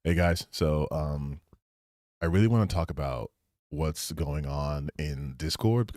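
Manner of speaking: low, soft, subdued video opening; serious video voice. Acted. Streamer opens a serious Discord video, low natural voice. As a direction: drop low and quiet, hesitant and unperformed. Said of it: "then I do a C S video".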